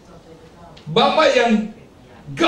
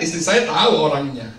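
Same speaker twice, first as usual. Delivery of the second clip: ordinary talk, nothing marked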